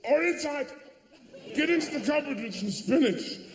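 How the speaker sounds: high, fretful mock parent; high fussing parent voice. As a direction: flap like an overanxious parent. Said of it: "people go".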